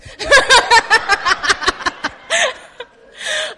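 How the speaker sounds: high, helpless cackling laugh; loud burst of laughter. Real, not acted. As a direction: crack up loudly mid-scene. Breaks into laughter mid-scene.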